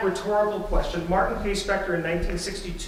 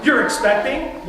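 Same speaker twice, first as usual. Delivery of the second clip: You're expecting thrown out higher and louder; excited disbelief; 6 semitones higher, 6 dB louder than usual. Shown as a demonstration. Parent hearing of a first grandchild, excited disbelief. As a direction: exclaim You're expecting like happy news just hit. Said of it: "in a very excited, declarative kind of way".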